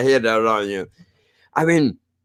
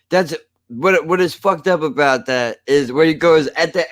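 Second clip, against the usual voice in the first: usual speaking voice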